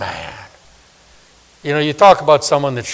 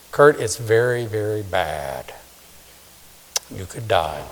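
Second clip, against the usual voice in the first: grave doctor; 5 semitones lower, 6 dB quieter than usual. Retold or imitated. Doctor gives grave report.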